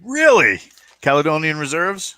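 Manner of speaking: high, sharp surprised question; genuinely taken aback. Real, not acted. Surprised by reservist coin news.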